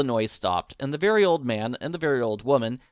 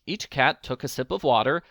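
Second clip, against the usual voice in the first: ordinary delivery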